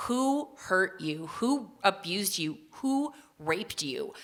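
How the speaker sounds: slow, soft probing questions; gentle probing. Retold or imitated. Nurse leaning in to ask patient, slow and soft. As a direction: lean in and ask slowly, gently. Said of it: "She leans in and she says".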